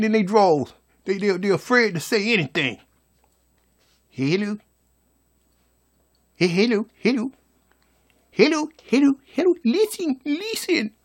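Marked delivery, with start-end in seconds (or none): 4.1-10.9 put-on high, small child's voice calling for attention; a scared kid pleading to be heard; little five-year-old. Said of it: "Like that little five-year-old".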